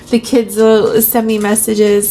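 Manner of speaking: ordinary delivery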